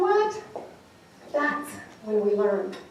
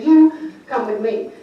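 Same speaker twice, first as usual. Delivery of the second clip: loud, deliberate mock command; grumpy buyer imitation, weight on each word; imitated angry order; 2 semitones lower, 8 dB louder, slower than usual. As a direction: summon someone like a cross boss. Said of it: "he looked angry".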